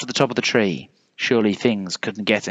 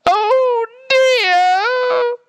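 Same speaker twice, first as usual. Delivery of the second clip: very high, long wailing scream; drawn-out shrieking bear; 4 dB louder than usual. A narrator's reading. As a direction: scream it as you fly through the air. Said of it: "screamed the polar bear".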